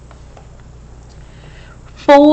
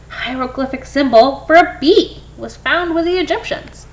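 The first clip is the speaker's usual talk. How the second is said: normal talking voice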